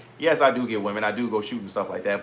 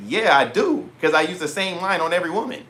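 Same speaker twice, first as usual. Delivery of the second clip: cheeky admission; 6 semitones higher than usual. Retold or imitated.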